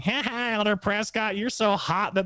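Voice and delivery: high-pitched mocking taunt; jeering sing-out with a fake laugh; smart-ass rich kid. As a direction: imitate a cocky kid gleefully teasing. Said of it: "really rich kid with a smart ass attitude".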